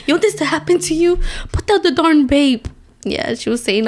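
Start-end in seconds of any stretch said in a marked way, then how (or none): 0.1-2.7 imitating a worked-up tearful warning, much higher; mock bawling. Crying girl warning viewers off vaping, mock bawling. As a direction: plead like the crying girl, hammed-up and high. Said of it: "she was bawling her eyes out".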